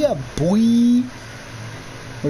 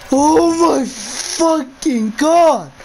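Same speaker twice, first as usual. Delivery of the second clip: long high scream, then slow loud cursing; scared scream; 7 semitones higher, 5 dB louder, much slower than usual. Real, not acted. Streamer startled once more, screaming slowly. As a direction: shriek then draw out the curse.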